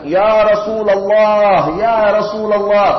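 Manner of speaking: loud, slow, high call across a crowd; drawn-out shouted call. Retold or imitated. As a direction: call out to him over the crowd. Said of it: "sengaja teriak".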